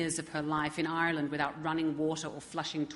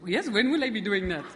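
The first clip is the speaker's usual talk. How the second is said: put-on a jokey Indian accent, louder and laughing through it; the Irish accent slides into Indian, played for laughs; mock Indian accent; 3 semitones higher, 6 dB louder than usual. Presenter redoes Hilda's reply in a joking Indian accent. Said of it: "they always sound Indian".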